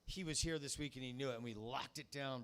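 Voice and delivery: ordinary talk, nothing marked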